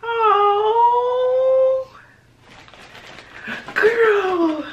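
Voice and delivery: high, loud delighted squeal; excited squeal. Real, not acted.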